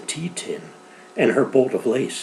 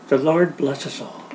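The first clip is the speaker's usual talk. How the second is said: ordinary delivery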